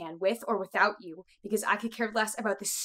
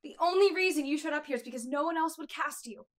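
louder angry snap; angry outburst; 5 semitones higher, 7 dB louder than usual. Acted. Makeup artist snapping at the actress, angry shout. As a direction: blow up at her out of pure frustration. Argument with actress over role.

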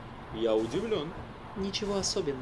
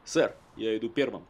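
normal talking voice

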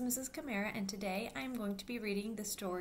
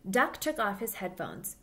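put-on lively storybook voice for little kids; reading to children; 3 semitones higher than usual. A narrator's reading. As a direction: read it aloud like storytime for children. Reads picture book to children.

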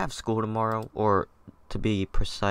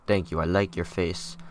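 ordinary talk, nothing marked

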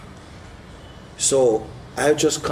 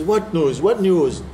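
higher, louder eager asking; eager question; 6 semitones higher, 3 dB louder, slower than usual. Retold or imitated. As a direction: grab at the news. Widow eager.

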